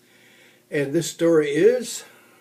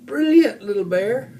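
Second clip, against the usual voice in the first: laughing; 6 semitones higher, 4 dB louder than usual. Acted. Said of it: "laughed Grandma".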